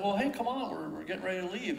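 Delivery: mock call to a distant child; calling over. Father calls child across river.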